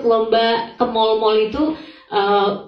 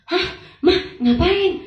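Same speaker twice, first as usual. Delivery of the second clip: retold surprise; 7 semitones higher, slower than usual. Retold startled reaction to mother.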